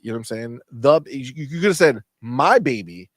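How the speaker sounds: ordinary talk, nothing marked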